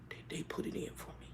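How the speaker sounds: barely audible whispered reply as him; imitated whisper. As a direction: whisper it haltingly, imitating his answer. Voicing Grumpy's confession.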